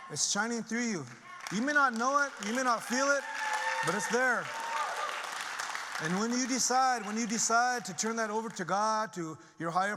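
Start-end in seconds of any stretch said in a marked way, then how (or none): 0.1-7.8 rising to a loud, high preaching cry; loud, fervent preacher's cry; impassioned preaching. Real, not acted. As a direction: proclaim it to the whole room. Preaching climax to audience.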